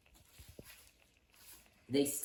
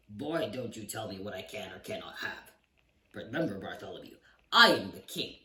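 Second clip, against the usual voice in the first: commanding king; 7 dB louder than usual. Acted. King asserts his authority.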